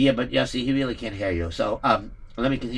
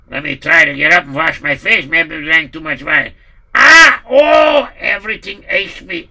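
pained groaning; 8 dB louder than usual. Acted. Poritz suddenly in pain.

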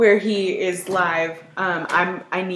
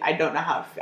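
ordinary delivery

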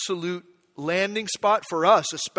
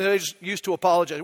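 ordinary delivery

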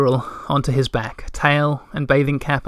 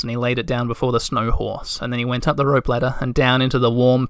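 ordinary delivery

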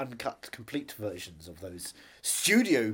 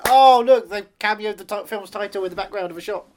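excited exclamation; 9 semitones higher, 14 dB louder than usual. Retold or imitated. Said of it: "you think".

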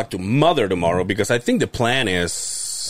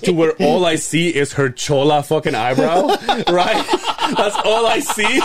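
talking through laughter; can barely get it out for laughing; 3 semitones higher than usual. Real, not acted. Describing aunt's eyebrow on FaceTime, laughing hard.

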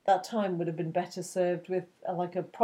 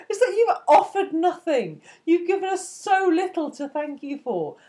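exasperated rant; 8 semitones higher, 5 dB louder than usual. Acted. Ranting at the useless companion.

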